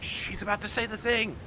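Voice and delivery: high, hushed mock fan anticipation; excited audience. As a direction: play the crowd bracing for the catchphrase. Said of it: "everyone in the audience is like".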